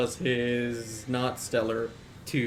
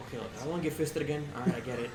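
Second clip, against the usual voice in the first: quick, softer cowed pleading; nervous hostage; 2 semitones higher, 5 dB quieter, faster than usual. Acted. Hostage begs Woodchuck not to hit him, nervous. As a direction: rattle it off, scared not to get hit. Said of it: "he's like".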